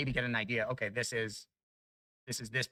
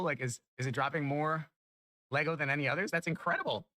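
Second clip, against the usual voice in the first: ordinary delivery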